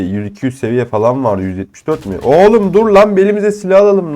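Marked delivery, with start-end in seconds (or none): 2.2-4.1 higher, louder frantic protest; begging enemies to wait; frantic plea. Real, not acted. Gamer begging attackers to wait, frantic.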